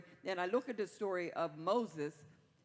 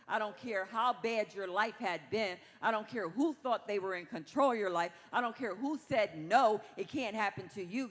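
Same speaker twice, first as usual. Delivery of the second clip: rising, louder preacher's cadence; full-force preaching; 5 dB louder than usual. Acted. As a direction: preach it to the congregation, building up.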